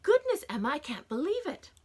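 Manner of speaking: high, loud gasp of wonder; awed sister praising Emma; amazed. A narrator's reading. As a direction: marvel at the picture. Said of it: "I said in wonder".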